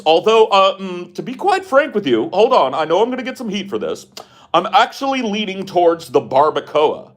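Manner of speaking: pompous mock announcer voice, pitched high; Vosh. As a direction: order food like an old-time broadcaster. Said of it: "does Vosh walk around talking like that?".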